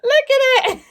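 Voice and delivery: very high, loud squeal through laughter; laughing delight. Real, not acted. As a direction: shriek it out, helpless with laughter. Showing off the ridiculous fabric.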